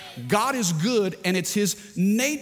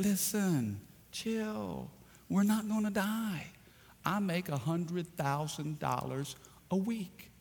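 much softer, laid-back reassurance; cool and unbothered; 3 semitones higher, 8 dB quieter, slower than usual. Acted. Rich castaway calms his panicking friend, soft and laid-back. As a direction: soothe him down, easy and quiet. Second man calms first man.

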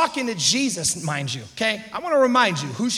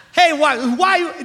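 louder, higher, drawn-out query; imitated clueless newcomer, pitched up; acted nosy questioner; 5 semitones higher, 5 dB louder, slower than usual. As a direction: butt in with a raised, halting question. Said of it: "they come in, they go".

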